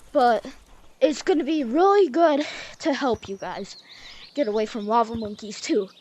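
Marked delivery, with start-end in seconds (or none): none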